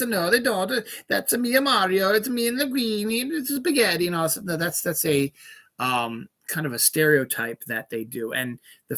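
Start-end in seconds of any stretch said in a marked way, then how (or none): 1.1-4.1 higher, bouncy mock Italian accent. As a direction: ham up the cartoon Italian plumber accent. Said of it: "Italians don't all talk like this".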